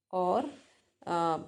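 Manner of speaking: ordinary talk, nothing marked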